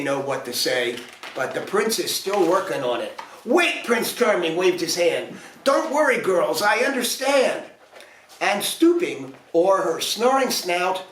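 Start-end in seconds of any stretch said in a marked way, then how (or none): 3.5-3.9 much higher eager call; eager wait. A narrator's reading. Prince interrupting the fairies, high eager call. 5.6-7.7 higher, sure-of-himself prince; confident prince. A narrator's reading.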